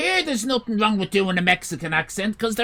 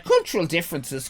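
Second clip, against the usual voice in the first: ordinary delivery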